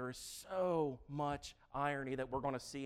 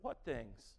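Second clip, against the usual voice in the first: deadpan; 4 semitones lower, 5 dB quieter than usual. Pretend. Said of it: "He's like".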